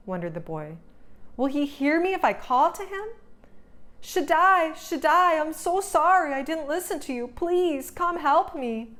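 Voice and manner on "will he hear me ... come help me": frightened plea. A narrator's reading. Lost boy calling to Shaddai for rescue, frightened and pleading. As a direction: call out desperately into the dark woods. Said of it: "began to cry".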